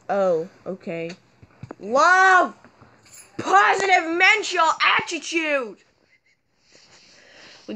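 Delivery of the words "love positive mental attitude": high, loud cheer; high mock-motivational cheer. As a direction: shout it out like a hype chant. Cheering at the camera.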